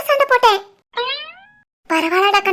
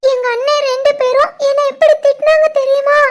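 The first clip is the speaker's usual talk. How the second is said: hammed-up tearful complaint; overacted crying; 3 semitones higher than usual. Shivani fakes distress to friend for Ram's ears, overacted crying. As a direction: wail it out for the eavesdropper. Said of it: "போதுண்டி, over ஆக்டா இருக்கு".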